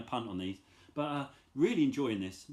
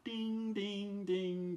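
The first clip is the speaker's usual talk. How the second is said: sung chime; 6 semitones higher, 4 dB quieter, much slower than usual. Retold or imitated. Imitating the song's chimes.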